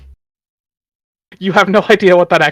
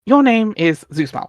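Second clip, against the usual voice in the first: slow, measured reading of a story; steady storybook narration; 7 semitones higher, slower than usual. A narrator's reading. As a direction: read it out like the comic's narrator. Reading Homestuck narration aloud.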